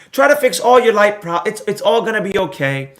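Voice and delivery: ordinary talk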